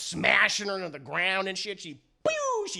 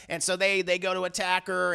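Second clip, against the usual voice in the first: ordinary delivery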